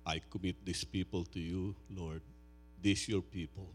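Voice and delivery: soft, low, reverent prayer voice. Acted. Pastor praying softly over his people before preaching. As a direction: pray quietly over the congregation.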